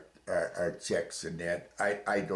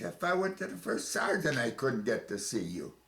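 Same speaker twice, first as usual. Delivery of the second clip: raised voice, furious retelling; angry hollering; 7 semitones higher than usual. Retold or imitated. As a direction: shout the grievance up at him. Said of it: "I was actually hollering".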